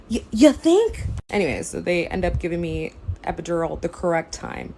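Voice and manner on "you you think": "You, you think?" higher and louder, fully exasperated; louder sarcasm. Retold or imitated. Exasperated shout back at the anesthesiologist. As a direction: hit "think" again, harder and incredulous.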